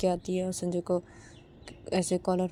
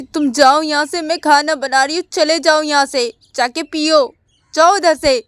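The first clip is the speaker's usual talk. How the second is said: loud, high angry yell; wife yelling from the kitchen; shouting; 7 semitones higher, 14 dB louder than usual. Retold or imitated. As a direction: scream at him to leave. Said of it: "चिल्ला के बोलती है".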